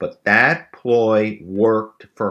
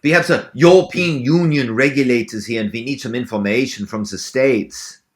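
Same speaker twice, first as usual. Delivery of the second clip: put-on German accent, pitched up; Gerhard, German accent; 3 semitones higher than usual. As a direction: play a caller with a crazy German accent. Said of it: "or the German accent ploy".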